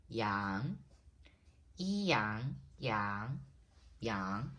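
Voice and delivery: "yang" starting low and gliding up; rising second tone. Shown as a demonstration. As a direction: say "yang" with a rising end, split once into "yi, yang". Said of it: "That is the rising tone".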